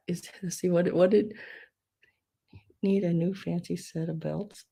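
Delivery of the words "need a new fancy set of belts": soft unvoiced whisper. Real, not acted. Seller reading a viewer's joke to herself, whispering. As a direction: breathe it under your breath.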